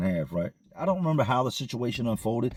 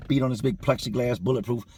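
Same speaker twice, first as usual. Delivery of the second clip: ordinary talk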